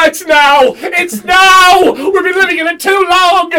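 loud, high-pitched yell of alarm; panicked shout. Acted. Poisoned patient realises time is up, panicked shout. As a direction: cry out in sudden panic.

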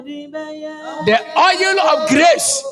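loud, ringing prophetic declaration; preaching at full force. Real, not acted. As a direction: proclaim it with rising force. Preacher declaring over congregant.

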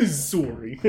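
amused Canadian "SORE-ee"; Canadian "sorry" with a round "or" vowel; Canadian accent. Retold or imitated. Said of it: "Both Canadian, I believe".

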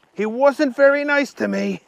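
high-pitched character complaint; complaining Archie. A narrator's reading. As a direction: protest it in a high voice.

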